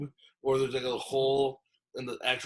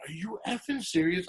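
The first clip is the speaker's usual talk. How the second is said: retold outburst, pitched well up; mock-angry disbelief, high but not shouted; flipping out; 8 semitones higher than usual. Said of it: "I, like, I, I flip a switch".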